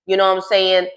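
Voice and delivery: normal talking voice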